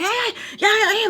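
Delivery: high-pitched mock panic; imitated freak-out. Mimicking him as she screams at him, freaking out. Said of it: "freaking out".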